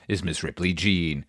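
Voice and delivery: usual speaking voice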